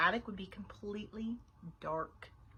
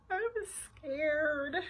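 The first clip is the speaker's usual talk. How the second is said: higher, very slow, quavering plea; quavery voice; 6 semitones higher, much slower than usual. A narrator's reading. Bean angling for babysitter's sympathy, quavery. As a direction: draw it out pitifully, quavering. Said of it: "she said in a quavery voice".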